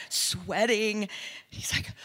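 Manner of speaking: usual speaking voice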